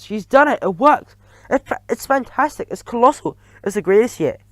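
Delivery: high, loud excited praise; cheering. A narrator's reading. Audience of witches praising, excited cheer. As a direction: gush over the spell.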